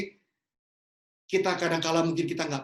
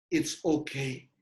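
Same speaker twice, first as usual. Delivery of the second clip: gentle comfort; 5 semitones lower, much slower than usual. Acted. Preacher consoling congregation.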